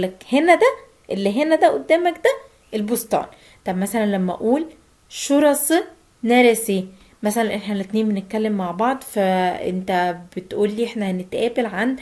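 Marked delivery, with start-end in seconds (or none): 5.1-6.8 very slow and louder; slow Turkish question demo. Shown as a demonstration. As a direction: spell out the question slowly for learners.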